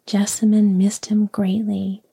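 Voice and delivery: ordinary delivery, nothing marked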